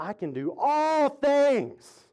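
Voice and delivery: slow down and swell hugely on "all things"; stress on ALL THINGS. Shown as a demonstration. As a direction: draw out "all" before landing "things". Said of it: "that emphasis is on all things".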